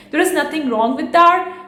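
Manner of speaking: ordinary talk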